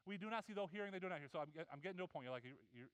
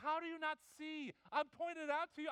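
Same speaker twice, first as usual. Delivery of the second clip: high, exasperated; fed-up father; exasperated dad; 8 semitones higher, 3 dB louder than usual. Retold or imitated. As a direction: throw up your hands at the kids.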